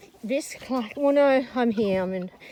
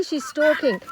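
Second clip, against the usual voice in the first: usual speaking voice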